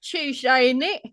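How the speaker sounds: slow, lightly faked British; British accent. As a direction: try a bad British accent. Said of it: "I'll try".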